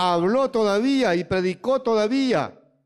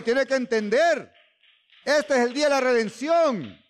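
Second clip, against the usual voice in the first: raised, fervent preacher's cadence; preaching; 6 semitones higher than usual. Acted. Preacher proclaiming victory to the congregation, fervent. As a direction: lift the congregation with each line.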